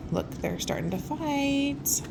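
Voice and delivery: ordinary delivery, nothing marked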